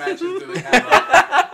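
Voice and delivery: loud, high, slow laughing question; laughing mock question. Friend laughing as he mimics himself browsing. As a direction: laugh through a mock musing.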